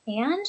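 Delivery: "and" lifting at the end; questioning rise on "and"; rising intonation. Shown as a demonstration. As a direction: say "and" as an upturned prompt to continue. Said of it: "with that rising intonation".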